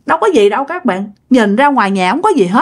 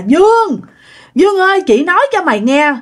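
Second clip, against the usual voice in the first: lightly voiced ghost, pitched higher; ghostly woman calling; 8 semitones higher than usual. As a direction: call the name like a complaining spirit. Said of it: "bả kêu vậy nè".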